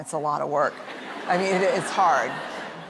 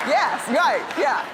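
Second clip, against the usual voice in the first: high, quick emphatic agreement; emphatic yes; 10 semitones higher, 3 dB louder, slower than usual. Retold or imitated. Mother telling her daughter she won't be grounded, emphatic. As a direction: jump on it with a higher-pitched yes. Mother eagerly reassures over scratching.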